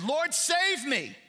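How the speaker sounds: high, strained cry for help, slow; desperate plea. Retold or imitated. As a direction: cry out to God as if going under. Preacher voices desperate plea.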